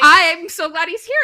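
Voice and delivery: put-on high, loud girlish gush of relief; thrilled gush. Friend voices Iris thrilled Roman came back, gushing. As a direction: squeal it out, overjoyed.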